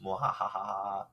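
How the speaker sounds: slow villainous laugh; evil laugh. Pretend. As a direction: mad scientist cackle, slow. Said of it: "just evil laugh here".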